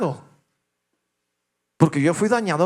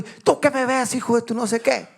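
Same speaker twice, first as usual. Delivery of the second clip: higher, mock shouted insult; imitating road rage yell; 6 semitones higher than usual. Father shouting at a driver who looks at him, angry. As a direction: snap at the other driver like the father.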